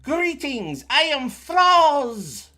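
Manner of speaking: high, slow, loud alien greeting; alien bird creature. Acted. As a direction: greet the strangers like a booming bird being.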